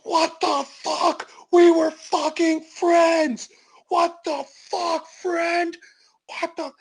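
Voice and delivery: high, strained, drawn-out outrage; acted betrayed rant. Homeless man chokes Bobby for betraying him, high strained outrage. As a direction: rage in a high voice while shaking someone.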